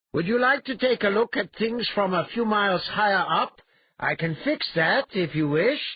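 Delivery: loud, high-pitched call, toying with the brute; taunting hero. Acted. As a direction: offer it loudly to someone high above. Hero calling up to suspended brute.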